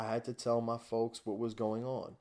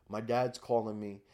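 ordinary talk

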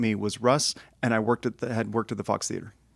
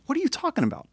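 much higher baffled what are you talking about; baffled question; faster than usual. Retold or imitated. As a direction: sound totally lost and baffled. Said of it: "He goes".

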